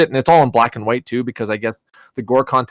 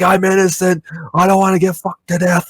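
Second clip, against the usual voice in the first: high-pitched hammed-up panic; mock desperate pleading; 8 semitones higher than usual. Victim shaking the gate to get out, mock panicked plea. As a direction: plead for your life, playing it for laughs.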